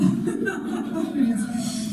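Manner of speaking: a quiet, high, breathy chuckle; chuckling after asking who can relate; soft high laugh. Real, not acted. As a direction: laugh along with the audience.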